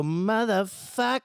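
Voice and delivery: drawn-out high scream of the line; mock stage scream. Singer reenacting his onstage yell. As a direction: belt it like the song's climax.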